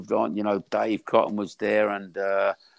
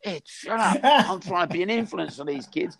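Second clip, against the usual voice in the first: higher, flustered self-defence; mock protest; 9 semitones higher than usual. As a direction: hush an old mate, pitched up. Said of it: "I said".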